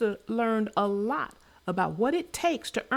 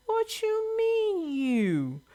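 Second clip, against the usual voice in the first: indignant; 11 semitones higher, much slower than usual. Real, not acted. Indignant reaction to farmer's claim.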